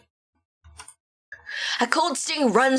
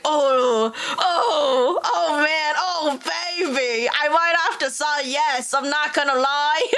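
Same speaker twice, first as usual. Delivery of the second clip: swooning; 4 semitones higher, slower than usual. Retold or imitated. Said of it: "but I was like".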